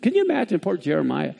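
usual speaking voice

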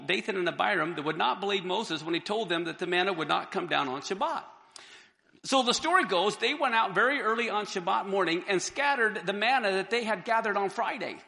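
normal talking voice

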